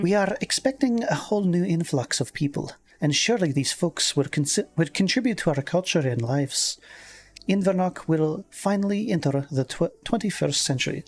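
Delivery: put-on lilting Scottish accent slipping towards Irish; female Scottish accent. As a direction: read it as a Scottish woman, leaning Irish. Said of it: "I'll do a female Scottish".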